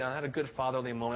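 normal talking voice

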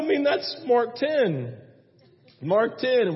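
ordinary talk

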